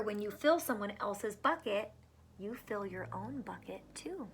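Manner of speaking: ordinary talk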